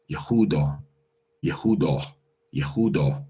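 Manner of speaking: Yehuda with a clear h, yeh-hoo-DAH; proper old pronunciation. Shown as a demonstration. Teacher demonstrating the oldest form, clear h sounds. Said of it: "the proper pronunciation of it".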